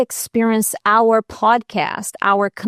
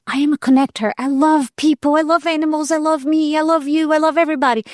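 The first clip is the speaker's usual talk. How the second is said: joyful affirmation; 6 semitones higher, faster than usual. Real, not acted. Affirming her new code.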